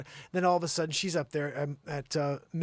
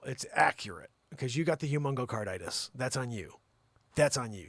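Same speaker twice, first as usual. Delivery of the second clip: normal talking voice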